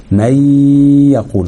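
very slow مي يقول with a long, level nasal y; two-count ghunna. Shown as a demonstration. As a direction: hold مي as a nasal hum before يقول. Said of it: "بالصورة دي".

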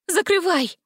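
high, urgent command; urgent cry. A narrator's reading. Girl tells prince to close portal, urgent. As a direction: urge him on, pitch jumping up.

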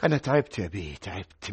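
soft, low, exhausted confession; worn-out sigh. Acted. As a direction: let the tiredness drain the voice. Clerk breaking down.

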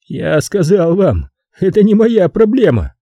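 high, wearily insistent voice; weary. A narrator's reading. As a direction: say it as if tired of repeating it. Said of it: "устало произнёс он".

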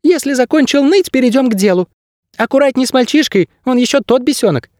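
very high, bossy little bird; squeaky bird guide. A narrator's reading. Bird guide warning his ward, bossy high squeak. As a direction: give orders in a tiny squeak. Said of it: "заявил Птах".

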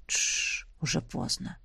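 hushed, low whisper. A narrator's reading. Man hushing the sleepy girl in his arms, whispering. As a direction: shush softly and whisper. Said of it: "прошептал".

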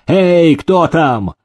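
high, drawn-out summons; calling out. A narrator's reading. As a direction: call servants from afar, long and high. Master calling for his servants.

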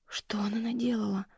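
беззвучный шёпот себе под нос; прошепчи в ужасе; испуганный шёпот. Acted. Ужас, шепчет себе. (дочь шепчет сама себе в ужасе)

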